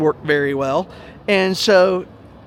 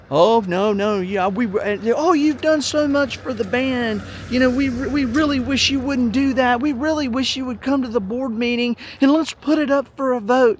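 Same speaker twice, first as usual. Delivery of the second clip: mock placating; 6 semitones higher, 4 dB louder than usual. Mocking the band board begging him not to buy it, syrupy. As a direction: sweet-talk him out of it, mocking them.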